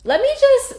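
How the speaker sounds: high excited inner voice; sudden resolve. Retold or imitated. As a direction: squeak out a sudden idea.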